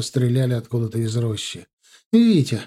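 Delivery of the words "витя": high, loud woman's call; sudden sharp cry of a name. A narrator's reading. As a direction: shout the name in alarm, pitched up. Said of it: "крикнула Наташа".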